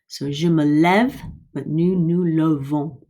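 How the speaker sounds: je me lève with open eh, nous nous levons with schwa; contrast demo. Shown as a demonstration. Teacher to class, modelling vowel contrast. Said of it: "The difference between grave".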